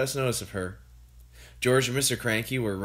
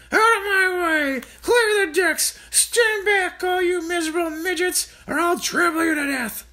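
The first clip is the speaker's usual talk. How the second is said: grandma shouting; 6 dB louder than usual. A narrator's reading. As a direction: roar it from a galloping pony.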